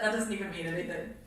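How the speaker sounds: plain reading voice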